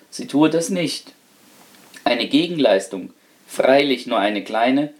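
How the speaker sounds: plain reading voice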